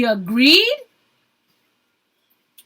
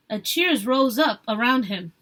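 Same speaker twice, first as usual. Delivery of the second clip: plain reading voice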